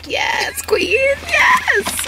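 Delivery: high excited cheer, starting hushed. Real, not acted. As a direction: squeal it with real excitement. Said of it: "I'm so excited".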